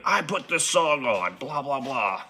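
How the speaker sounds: mock the guy who keeps hovering; mimicking the pushy bar guy. Narrator mimics the pushy bar guy, slow and high. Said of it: "he go".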